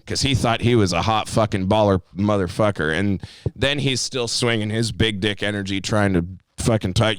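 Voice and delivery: ordinary talk, nothing marked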